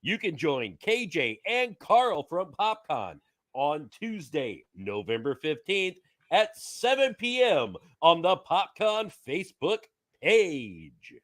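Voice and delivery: big hammed-up show announcer; cheesy announcer voice. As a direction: read it like a promo, punching the key words. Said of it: "announcer voice".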